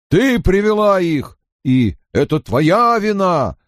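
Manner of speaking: high-pitched calls from a crowd; accusing cries. A narrator's reading. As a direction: cry out blame like an angry villager. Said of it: "раздались нестройные выкрики". (обвиняющие выкрики из толпы)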